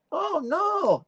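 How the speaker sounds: high-pitched mock denial; hard-of-hearing man. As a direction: imitate the hotel man answering in a squeaky voice. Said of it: "He can't hear".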